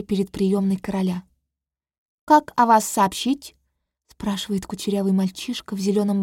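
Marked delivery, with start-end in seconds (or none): 2.2-3.6 put-on a young servant asking politely; page boy voice. Page boy asks at the door. (голос юного пажа)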